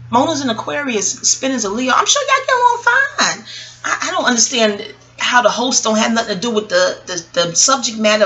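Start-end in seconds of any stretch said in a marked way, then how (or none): 1.9-3.4 high, sugary sarcastic reassurance; fake-nice voice aimed at the host; mock sweet. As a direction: sweeten it until it turns to sarcasm. Teasing a host about compatibility.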